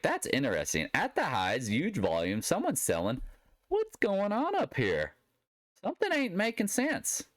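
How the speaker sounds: put-on high-pitched, drawn-out Southern accent; Southern roots voice. Trader reacting to a strange chart anomaly, high Southern voice. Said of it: "I'm talking my Southern roots voice".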